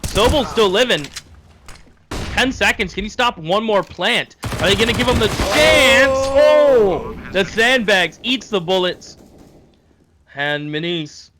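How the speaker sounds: loud, high esports call building to a shout; live play-by-play. Real, not acted. Caster calls the last ten seconds, shouted excited play-by-play. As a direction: raise the call to a big climax.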